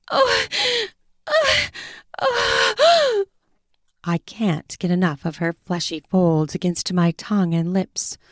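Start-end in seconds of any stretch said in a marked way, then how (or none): 0.1-3.2 high rising moans; moaning. Acted. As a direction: let out breathy high moans. Woman moaning during sex.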